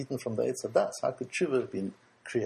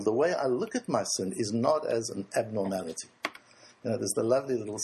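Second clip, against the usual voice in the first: ordinary talk, nothing marked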